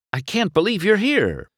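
high, mildly dazed wonder. A narrator's reading. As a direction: say it in lightly acted disbelief. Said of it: "said in a dazed voice".

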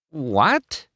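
high, rising baffled question; confused. A narrator's reading. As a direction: ask in total confusion. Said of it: "McQueen was really confused now".

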